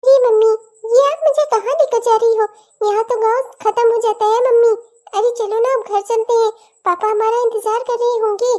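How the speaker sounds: a small daughter puzzled by her mother; little girl. Acted. Daughter asks mother where they're going, little girl voice.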